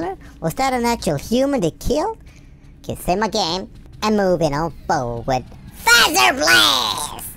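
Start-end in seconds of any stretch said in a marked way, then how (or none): 5.8-7.3 slow excited shout. Real, not acted. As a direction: belt out the move name. Shouting a game move name.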